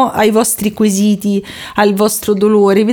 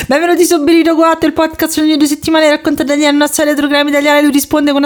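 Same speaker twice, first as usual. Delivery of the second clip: lively host welcome, higher; podcast intro; 6 semitones higher, faster than usual. Acted. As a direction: announce the show with energy.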